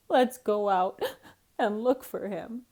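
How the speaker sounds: tearful cat voice; weeping cat. A narrator's reading. Cat urging squirrel to search for duck, weeping. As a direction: keep weeping through the words. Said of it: "wept the cat".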